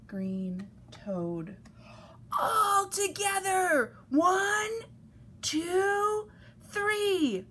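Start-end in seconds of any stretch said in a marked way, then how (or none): 2.4-7.4 high, stretched-out group count; rallying count. A narrator's reading. Friends all push the trucks, rallying count. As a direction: call the count out to a team.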